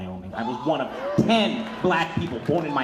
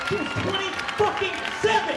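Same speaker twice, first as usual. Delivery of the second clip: outraged cry; 3 dB louder than usual. Real, not acted. Outrage over Breonna Taylor's death.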